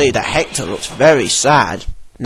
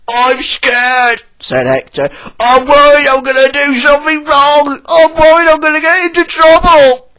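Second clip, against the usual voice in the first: much higher, louder worried voice; scared Hector; 11 semitones higher, 5 dB louder than usual. A narrator's reading. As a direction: blurt out the fear. Hector admits being scared.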